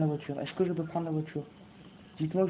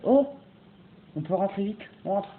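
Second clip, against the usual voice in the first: normal talking voice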